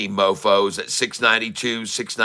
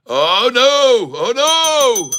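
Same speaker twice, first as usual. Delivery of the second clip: high falsetto oh no, much higher and louder; mock falsetto wail; 11 semitones higher, 6 dB louder, much slower than usual. Streamer to chat as the price drops, mock falsetto wail. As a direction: wail it like a cartoon in despair.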